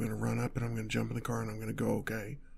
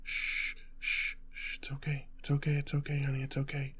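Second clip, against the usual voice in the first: higher whispered reassurance; hushed soothing; 3 semitones higher, 4 dB quieter than usual. Acted. Calming honey while hiding from pursuers, hushed soothing. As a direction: shush and comfort under your breath.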